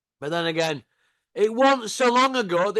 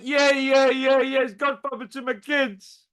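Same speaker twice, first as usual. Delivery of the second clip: mocking Big Malk; 6 semitones higher than usual. Taunting rival Big Malk, a mocking higher yes-man voice. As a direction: ham up his eager yes-man yeahs. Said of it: "you went".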